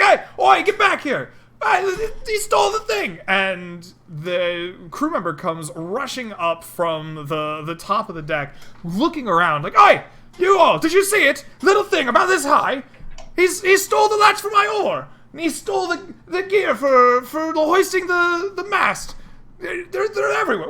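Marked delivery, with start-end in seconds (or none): 0.0-3.2 high, loud angry yell after a thief; yelling crew member, much higher and louder; angry sailor shout. Acted. 9.8-20.7 high, loud panicked sailor shouting; a crew member shouting to everyone, robbed and frantic; high shouting deckhand voice. Acted.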